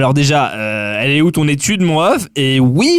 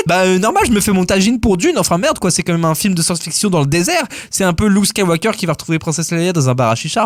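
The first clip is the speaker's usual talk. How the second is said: ordinary talk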